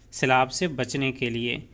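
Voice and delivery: usual speaking voice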